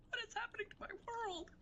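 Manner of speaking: very high, small squeaky wail; hammed-up distress in a tiny high falsetto, hurried; quick, squeaky, helpless little cry; squeaky mock despair. Acted squeaky cry of bewilderment.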